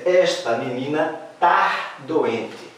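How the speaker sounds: slow, deliberate "Esta menina tá doente"; slow demo. Shown as a demonstration. Teacher to class, slow model sentence. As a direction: spell out "tá" slowly for the class. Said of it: "E se eu disser".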